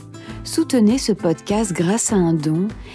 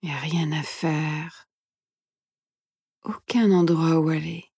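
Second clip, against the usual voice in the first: soft, hushed meditation guide; guided meditation whisper; 5 dB quieter than usual. Acted. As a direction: whisper gently, leaving long pauses.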